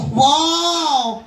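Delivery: ordinary delivery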